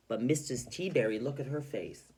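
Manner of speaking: ordinary talk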